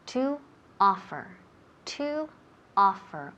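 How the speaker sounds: word by word, a pause after TO; teacher repeating. Shown as a demonstration. Teacher repeating a verb for the student, word-by-word model. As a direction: model "To offer" in separated pieces. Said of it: "let me repeat".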